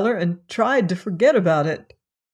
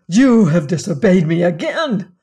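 master's angry cry, louder; 7 dB louder than usual. Acted. Master catching the lad disobeying, furious and loud. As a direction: shout the accusation in anger. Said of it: "cried he".

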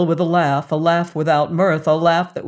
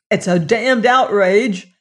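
indignant outburst, louder; 4 semitones higher, 6 dB louder than usual. Acted. Venters protesting to the Mormons, indignant burst. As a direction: burst out in outrage. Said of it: "burst out Venters".

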